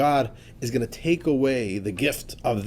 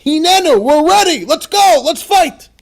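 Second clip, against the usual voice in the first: high, loud rallying cry; totally excited; 12 semitones higher, 11 dB louder, slower than usual. Retold or imitated. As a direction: fire them up, ready to fight. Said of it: "they are totally excited".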